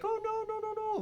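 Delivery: high, whiny mock-sad "no, no, no". Friend mocking women who cry about abortion, whiny high voice. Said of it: "like, all sad".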